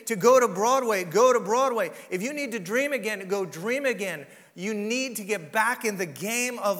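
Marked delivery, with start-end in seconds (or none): 0.1-1.9 forceful preaching, loud and insistent; loud exhortation. Real, not acted. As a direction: urge them on with volume.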